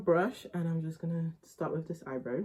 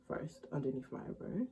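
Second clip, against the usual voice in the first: ordinary delivery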